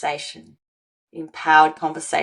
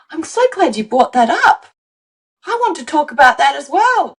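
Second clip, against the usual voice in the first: higher, eager mock delight; glad reply; 7 semitones higher, 4 dB louder than usual. Coach voicing a partner glad the issue was raised, eager. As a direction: welcome the topic gladly.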